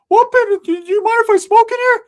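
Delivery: very high, loud squeaky friend voice; Ricky's squeaky voice. Retold or imitated. Hyped friend squealing to the narrator, very high and loud. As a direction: pitch it way up and squeal it out. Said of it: "Ricky's in the background".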